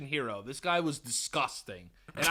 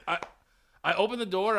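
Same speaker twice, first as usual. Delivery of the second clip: ordinary delivery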